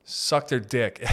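ordinary talk, nothing marked

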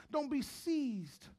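usual speaking voice

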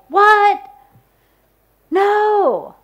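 high, loud shocked outcry; loud, high wail of dismay; dismayed cry. Acted. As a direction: cry out in disbelief at the letdown. Disappointed listener cries out.

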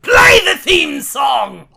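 loud, slow shouted cue; shouting. Acted. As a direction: bellow it across the room.

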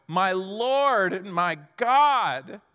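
loud, drawn-out cry of awe; exclaimed shout. Acted. Overwhelmed Thomas exclaims to his Lord, shouted out. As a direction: cry it out slowly and loudly, overwhelmed. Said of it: "he shouted out".

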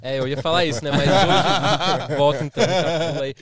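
loud laugh through words. Real, not acted. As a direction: laugh while talking.

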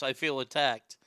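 ordinary delivery

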